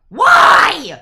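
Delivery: sudden loud pained yell; rage scream. Retold or imitated. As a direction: yell it out in exasperation. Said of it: "mostly me and him rage screaming".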